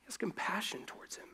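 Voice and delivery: breathy whispered emphasis. Real, not acted. Preacher whispering to the congregation about Jesus's compassion. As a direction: let compassion fall to a whisper. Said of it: "whispered emphasis".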